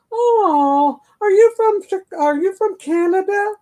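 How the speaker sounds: mock the beach girl's high amazed question; imitating Alabama woman. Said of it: "And she said".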